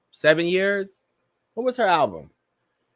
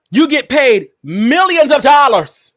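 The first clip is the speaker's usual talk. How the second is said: slow, loud and high, every word punched; mock outrage at a rapper's paycheck; exaggerated outraged emphasis; 8 semitones higher, 8 dB louder, slower than usual. Real, not acted. Host lecturing a rapper, slow loud outrage.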